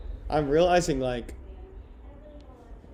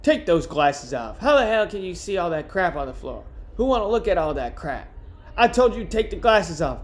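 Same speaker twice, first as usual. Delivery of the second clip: higher, irritated scolding in character; irritated book character; 4 semitones higher than usual. Acted. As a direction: scold someone in an exasperated character voice.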